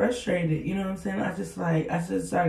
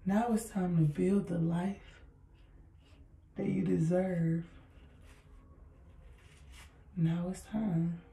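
softer, slower, letting it sink in; soft, slow repeat; 9 dB quieter, slower than usual. Real, not acted. To her viewers after a pause, a soft slow dramatic repeat. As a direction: drop the voice and let the words land.

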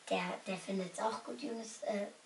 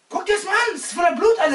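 loud, fast, higher yell; acted outrage; 9 semitones higher, 18 dB louder, faster than usual. Prankster showing the blood, loud fast yell. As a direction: push the fake blood in his face.

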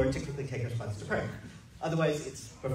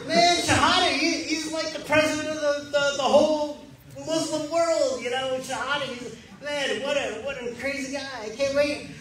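high, excited, wide-eyed; mock awed excitement; 9 semitones higher, 4 dB louder than usual. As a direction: gush like meeting a celebrity. Naive awe at Shahada.

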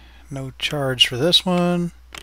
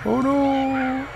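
high squeaky little guest; 13 semitones higher, slower than usual. Pretend. Voicing park guests in high voice.

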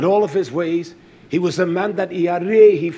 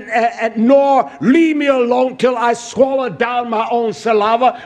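anguished outcry; 6 semitones higher, 6 dB louder than usual. Acted. Job cries out to Yah.

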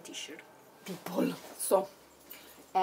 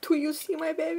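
much higher, fast gushing; 10 semitones higher, faster than usual. Real, not acted. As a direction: show off the toy, delighted. Showing toy to viewers.

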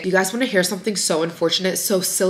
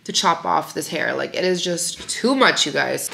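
ordinary delivery

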